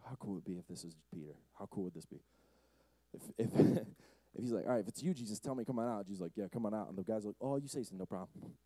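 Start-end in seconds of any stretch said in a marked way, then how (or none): 0.1-2.2 low, very quiet musing; hushed aside. Acted. Pastor imagining a calmer Peter, hushed. 4.7-8.5 low, quiet, offhand dialogue; casual understatement. Acted. Pastor replaying Peter and Jesus, casual and hushed. Imagined easygoing version of scene.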